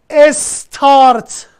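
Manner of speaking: استارت as "es-tart", with e before the s; slow, stretched "es-TART" with an added opening vowel; Persian pronunciation. Shown as a demonstration. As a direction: split استارت slowly into "es... tart".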